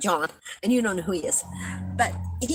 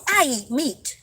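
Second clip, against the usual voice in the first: higher mock boast announcing meat eating; mock announcement; 5 semitones higher than usual. Mocking how vegetarians announce themselves, in a higher boastful tone. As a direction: playfully parody a proud announcer. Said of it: "I do not walk up to anybody and go".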